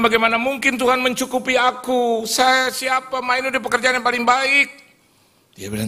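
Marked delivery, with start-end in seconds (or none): 0.0-4.7 high, loud pleading; distressed protest. Retold or imitated. Son refusing his mother's advice to quit, loud high protest. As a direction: argue back in a raised, high-pitched voice.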